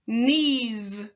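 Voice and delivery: "knees." ending in a voiced z; teacher stretching the final z; final buzz. Shown as a demonstration. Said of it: "Last one".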